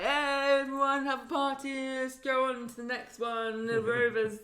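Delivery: loud, tipsy party call; mock drunk. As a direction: ham up a drunk woman rallying everyone. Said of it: "Faye gets completely drunk and she's like".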